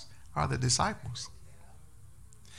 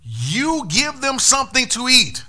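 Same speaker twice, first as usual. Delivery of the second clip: slow, high, loud command; preached command; 9 semitones higher, 6 dB louder than usual. Acted. Preacher voices Jesus commanding the disciples, slow and loud. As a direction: proclaim it slowly, up high.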